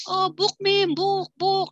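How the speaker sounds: high, loud eager answer; eager students. Retold or imitated. Students racing to answer the teacher, high and loud. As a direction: blurt it out to be first. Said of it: "Atau mereka jawabnya ini tuh, rebutan".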